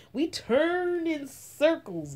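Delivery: ordinary delivery, nothing marked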